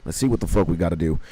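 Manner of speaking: ordinary delivery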